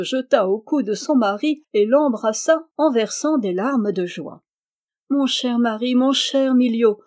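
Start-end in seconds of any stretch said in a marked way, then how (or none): 5.1-7.0 higher, heartfelt cry of joy; joyful exclamation. A narrator's reading. Princess embraces her husband, exclaiming joyfully. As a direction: exclaim it with loving delight. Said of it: "s'écria-t-elle".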